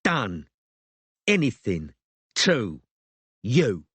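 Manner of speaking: each word hit with a punch; words broken by blows; punching while talking. A narrator's reading. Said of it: "punching her with every subsequent word".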